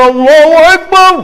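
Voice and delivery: rousing takbir cry; takbir shout. Real, not acted. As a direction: call it out with fervour. Preacher exclaims takbir.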